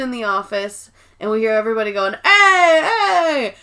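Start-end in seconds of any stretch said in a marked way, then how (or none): 2.2-3.5 high, loud excited calls; excited shouting. Retold or imitated. Office workers calling out that David Wallace arrived, loud and high. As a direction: shout the news across the office.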